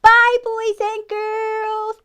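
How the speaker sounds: very high, loud, drawn-out farewell; little friends' voice. Acted. Presenter's friends say goodbye to children, high put-on voice. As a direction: sing it out slowly in a high put-on voice. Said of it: "my friends wanna say goodbye".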